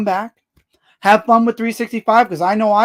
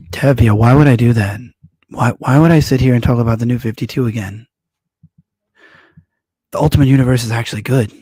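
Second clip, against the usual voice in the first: much lower, louder register; deep voice; 8 semitones lower, 6 dB louder than usual. Shown as a demonstration. Streamer challenging a viewer's request for a comparison, deep voice. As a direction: drop into a deep, forceful voice. Drops back into demonstrated deep voice.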